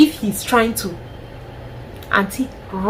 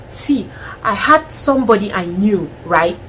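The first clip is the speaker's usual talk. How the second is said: ordinary delivery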